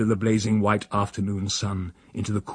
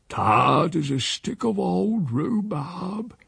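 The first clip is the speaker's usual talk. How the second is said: slow, drawn-out taunt, rising high; goading stage whisper; 9 semitones higher, much slower than usual. A narrator's reading. Uncle needles the housekeeper within earshot, goading aside. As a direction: let the jibe slip out, meant to be overheard. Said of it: "said in a whisper".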